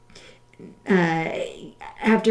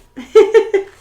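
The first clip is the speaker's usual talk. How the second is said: burst of laughter; 13 semitones higher, 12 dB louder than usual. Real, not acted. Laughs at her own joke.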